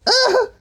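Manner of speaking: high, loud excited scream; wild shriek. Real, not acted. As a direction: let out a crazed yelp. Gaming frenzy.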